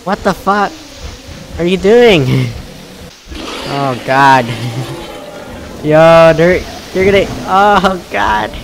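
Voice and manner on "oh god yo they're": ordinary talk, nothing marked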